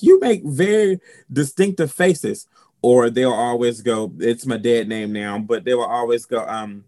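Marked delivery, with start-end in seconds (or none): 0.0-2.5 higher, louder, slower lightly put-on voice; someone else's remark. A friend remarking on the faces, lightly mimicked in a higher, louder, slower voice. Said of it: "someone was like".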